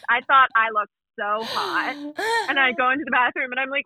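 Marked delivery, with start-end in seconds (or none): none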